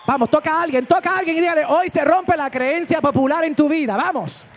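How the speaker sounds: loud, fervent preaching, slowing on key lines; full-force preaching. Acted. Pastor rousing the congregation, loud fervent preaching. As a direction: preach it out to the whole church.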